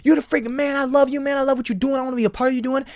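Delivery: hammed-up roommate hyping him up; fake hype friend. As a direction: overdo the fake praise. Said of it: "come around and be like".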